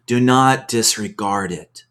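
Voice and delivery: normal talking voice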